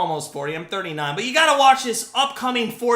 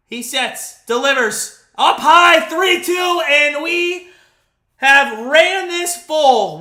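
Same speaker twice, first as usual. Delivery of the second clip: play-by-play call building to a shouted strike-out, higher and louder; live play-by-play; 4 semitones higher, 4 dB louder than usual. Acted. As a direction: call the at-bat like a TV announcer, building to a yell on the pitch.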